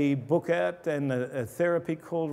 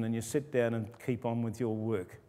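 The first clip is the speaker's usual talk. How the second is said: usual speaking voice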